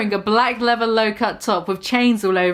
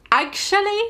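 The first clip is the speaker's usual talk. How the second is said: "Actually?" jumps much higher as a teasing question; 9 semitones higher than usual. Acted. Lupin repeats "Actually?" high to sell his pun. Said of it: "Get it?".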